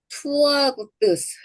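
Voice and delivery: 투어부트스 drawn out slowly, every consonant sounded; slow teacher's model word; slow careful pronunciation. Shown as a demonstration. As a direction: spell out 투어부트스 syllable by syllable. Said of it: "그래서 우리 뭐죠?".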